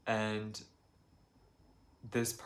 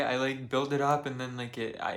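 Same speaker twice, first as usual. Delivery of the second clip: usual speaking voice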